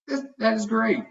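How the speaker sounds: high-pitched, delighted surprise; pleasantly surprised mom. A narrator's reading. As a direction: praise a child in happy surprise. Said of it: "surprised by the comment".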